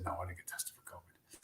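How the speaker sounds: breathy mock whisper of his reply. Telling the manager on the phone he wasn't tested, whispered. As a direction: whisper it like a secret aside.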